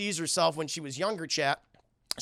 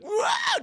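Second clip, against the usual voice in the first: high-pitched mock yell; sudden squealed wail; imitated startled scream. Girl swallowed again by the substance, mock scream.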